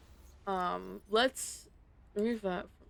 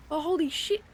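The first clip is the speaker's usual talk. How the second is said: loud high exclamation; surprised; 8 semitones higher, 7 dB louder than usual. Real, not acted. Sudden discovery.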